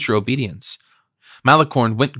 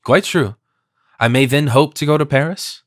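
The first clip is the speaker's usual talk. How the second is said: ordinary delivery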